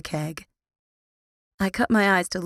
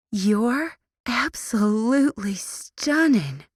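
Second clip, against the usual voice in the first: slow Southern country twang, a bit higher; 5 semitones higher, slower than usual. A narrator's reading. Tori to her roommate, slow country-twang compliment. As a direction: drawl the compliment with a country lilt. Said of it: "in her country twang".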